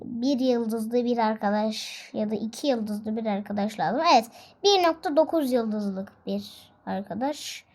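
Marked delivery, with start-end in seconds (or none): none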